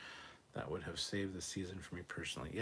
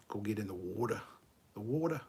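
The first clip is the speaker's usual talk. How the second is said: mock Australian accent on "water". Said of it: "the way Australian people say water".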